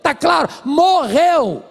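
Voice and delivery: high, loud exasperated outburst; angry wife insisting her marriage is dead; angry retort. Retold or imitated. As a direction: hammer the word "morreu" at him. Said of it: "A mulher ficou muito brava comigo".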